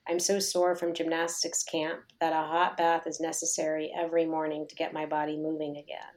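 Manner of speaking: ordinary talk, nothing marked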